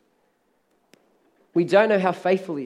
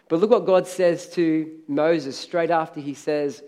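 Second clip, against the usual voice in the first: ordinary delivery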